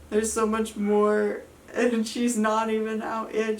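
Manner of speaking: retold despair while pushing; tearful. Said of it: "I start crying because I'm like".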